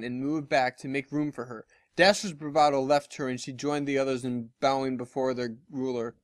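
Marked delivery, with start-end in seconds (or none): none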